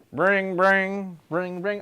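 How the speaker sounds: trilled mock telephone ringing; "bring, bring" as a ringing phone; imitated phone ring. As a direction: make a vocal ringtone.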